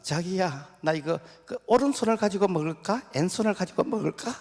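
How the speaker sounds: high, loud, cutesy bride voice; coy bride. Acted. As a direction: ask sweetly like a doting new bride. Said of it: "그 신부가". (신혼 신부의 높고 애교 섞인 목소리)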